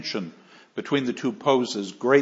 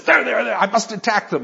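eager Quixote; 7 semitones higher, faster than usual. Retold or imitated. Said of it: "He says".

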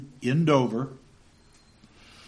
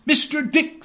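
higher, louder brisk orders; commanding aunt; 13 semitones higher, 4 dB louder than usual. Acted. Aunt sending Janet for Mister Dick, brisk commanding voice. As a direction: order the servant about. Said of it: "said my aunt".

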